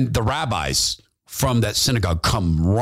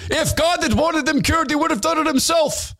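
higher, indignant protest; a rabbi scolding, pitched up high; 14 semitones higher than usual. Retold or imitated. Rabbi objecting to Jesus after the healing, higher indignant voice. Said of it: "says to the actor who plays the Lord Jesus".

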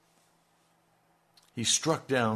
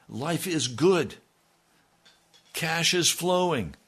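sarcastic preaching; 7 semitones higher, 6 dB louder than usual. Acted. Preacher mocks Israel's comfort.

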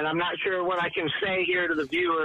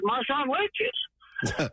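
mock triumphant taunt; 12 semitones higher, much slower than usual. Taunting the heckling crowd back.

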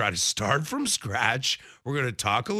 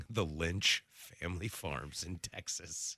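low, softer Texas-flavoured announcement; mock Texas accent; 8 semitones lower, 11 dB quieter than usual. Host teasing rancher co-host, low Texas accent. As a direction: intone it as a hushed Texas farm advert.

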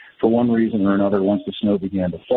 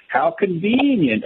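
Church Lady; 8 semitones higher, slower than usual. Retold or imitated. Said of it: "as the church lady used to say".

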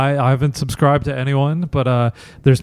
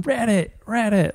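mock heckler; 8 semitones higher, 6 dB quieter than usual. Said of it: "She's just going".

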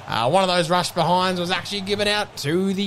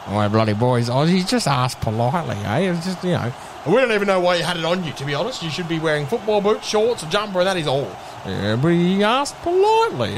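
low-voiced player with sudden pitch jumps; defensive bloke caught out, mostly low; sheepish footballer, making excuses; 4 semitones lower than usual. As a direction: protest your innocence to the officials. Plays mic'd-up player Michael Schneiker.

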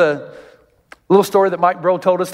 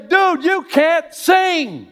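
blunt friend yelling; 10 semitones higher than usual. Retold or imitated. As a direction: yell it at him so he finally hears. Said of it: "the friend that will say".

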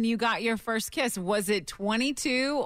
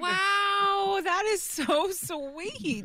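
touched; 8 semitones higher, much slower than usual. Real, not acted. Reacting to caller's sweet story.

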